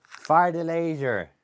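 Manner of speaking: drawn-out, high order; slow commanding. Shown as a demonstration. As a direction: spell it out for the bird. Commanding parrot.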